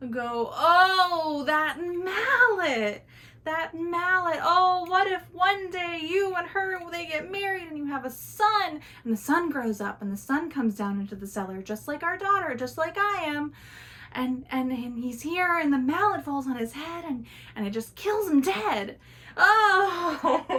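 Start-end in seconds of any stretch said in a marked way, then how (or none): 0.5-8.8 family wailing together. Acted. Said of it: "all three of them together go". 11.6-20.4 family wailing together. Acted. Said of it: "all three of them together go".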